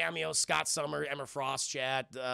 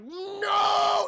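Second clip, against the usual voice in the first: mock anguished shout; 5 dB louder than usual. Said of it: "And he's like".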